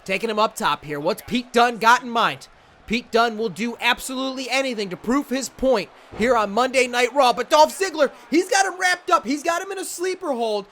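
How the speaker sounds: high, excited ringside commentary; play-by-play. Acted. Calling the Dunne versus Ziggler match, excited play-by-play. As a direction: call every move as it lands. Calls the simulated match live.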